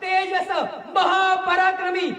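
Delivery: high, drawn-out ceremonial herald's shout; Shivgarjana war cry. Real, not acted. Orator rousing sleeping mavlas with Shivgarjana, slow roaring proclamation. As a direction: proclaim each title at full voice, stretching it out. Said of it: "एक शिवगर्जना करतो".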